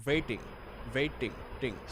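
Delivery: "waiting" in an Indian accent, V for W; Indian-accent "waiting" with a hard final "ding". Shown as a demonstration. Teacher imitating the mix-up for learners, Indian accent. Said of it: "trying to do that typical Indian accent".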